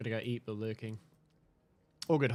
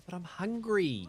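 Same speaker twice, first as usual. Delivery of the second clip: whiny; 9 semitones higher, 3 dB louder, slower than usual. Acted. Whining reply about eating.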